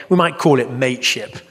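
ordinary talk, nothing marked

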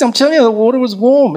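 high, loud retold protest; emphatic insistence. Friend answers the speaker's disbelief, insistent. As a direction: insist loudly, pitch jumping well up.

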